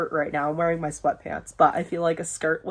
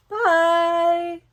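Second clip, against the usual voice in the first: cheery goodbye; 11 semitones higher, 3 dB louder than usual. Real, not acted. Cheerful sign-off to viewers.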